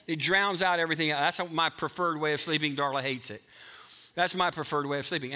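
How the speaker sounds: normal talking voice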